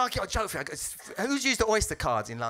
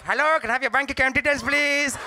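higher, louder Indian call centre voice; loud, higher Indian call-centre scam voice; Indian call centre accent; 8 semitones higher, 6 dB louder than usual. Retold or imitated. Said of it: "More like".

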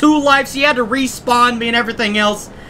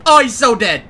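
ordinary talk, nothing marked

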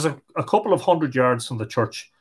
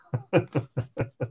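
soft eerie chuckle; 8 dB quieter than usual. A narrator's reading. Corpse laughs.

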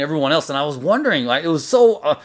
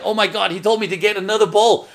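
retold dismay; 6 semitones higher than usual. Retold dismay at another bowl.

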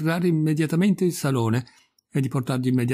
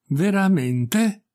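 very drawn-out VERAMENTE, higher and rising at the end; slow, deliberate exclamation; 6 semitones higher, much slower than usual. A narrator's reading. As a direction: stretch "Veramente" slowly, as if the idea is sinking in. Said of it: "esclamò il barone come se si lasciasse impressionare lentamente e deliberatamente". ("Veramente" lento e deliberato, in tono ascendente)

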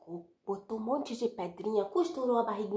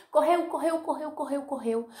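running fast; 6 semitones higher, 4 dB louder than usual. A narrator's reading. Wolf running, repeated excitedly.

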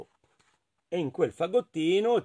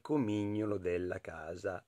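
hushed; 7 semitones lower, 11 dB quieter than usual. A narrator's reading. Tale line trails into hush.

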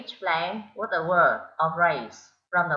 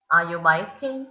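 yes-no question rising at the end; rising question tune; slower than usual. Shown as a demonstration. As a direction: rise at the end on baking. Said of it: "Mình sẽ lên giọng vì đây là một câu hỏi yes no".

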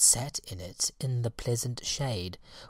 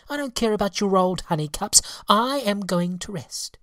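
high, louder, quick and sulky; petulant fairy; 8 semitones higher, 5 dB louder than usual. Acted. Lazy fairy refusing the moths, high and petulant. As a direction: brush them off like a spoilt child.

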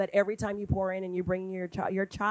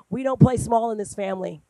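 firm pep talk; 3 semitones higher, 8 dB louder than usual. Retold or imitated.

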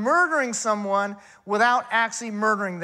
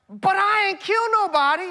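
high-pitched, louder mock boast of innocence; self-righteous boast; 9 semitones higher, 4 dB louder than usual. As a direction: ham up the smug sinner's protest, pitch jumping high. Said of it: "they would say something like this".

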